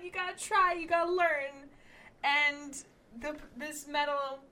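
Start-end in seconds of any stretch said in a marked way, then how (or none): 0.0-1.7 encouraging. Retold or imitated. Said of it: "And I was like".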